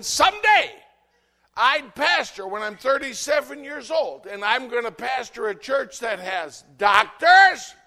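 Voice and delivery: high, very loud preaching shout; triumphant shout. Real, not acted. Preacher shouting his life story to the church.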